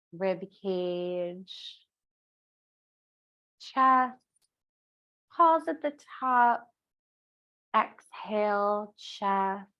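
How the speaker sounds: very spaced-out, stretched breath cues. Shown as a demonstration. Teacher guiding the last round of breath, slowed right down. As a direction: lead one long breath, pausing between each step. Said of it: "maybe slow it down a little more".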